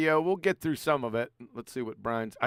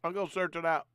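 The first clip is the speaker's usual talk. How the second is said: talking with a mouthful; mouth full; 4 semitones higher, 4 dB louder, faster than usual. Real, not acted. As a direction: chew and talk at once. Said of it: "he says his mouth full".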